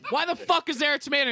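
high, loud imitation of an outraged customer; mock angry confrontation. Co-host mimicking friend's first version of story, mock outraged. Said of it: "Two totally different vibes".

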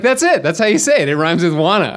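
high, loud eureka; like you just cracked the code; excited. Retold or imitated. Excited realization, retold.

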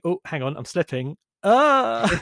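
hammy dying plea, then a cry; overacted death scene. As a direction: ham up Bond slipping off the propeller. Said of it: "He, he dies like a real punk".

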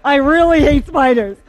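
loud, high, laughing through the words; acted admission with laughter breaking in; laughing confession. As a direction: confess it while cracking up. Man's confession, told through laughter.

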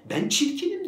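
high-pitched mock lament; acted poor-me voice; self-absorbed self-pity. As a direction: wail it as someone sunk in themselves. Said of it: "kendine gömülmüş şekilde".

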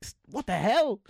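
high, loud, hammed-up disbelief; mock shock. Parent looking at grown kids, loud mock shock. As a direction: blurt out stunned amazement. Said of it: "I'm like".

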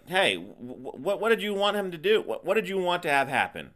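worked-up comeback to a co-host; exasperated challenge. Real, not acted. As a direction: sputter it out in disbelief.

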